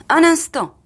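high, loud interruption; sudden call to halt. Acted. Husband stopping the car as it pulls away, sudden call. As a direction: call out sharply to stop everything.

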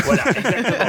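laughing. Real, not acted. Scientist laughing along at the host's joke about his mic. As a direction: agree through a real chuckle.

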